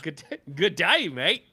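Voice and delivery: Australian accent, overdone and cartoonish; mock Aussie hello in a Thor voice. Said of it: "Australian accent".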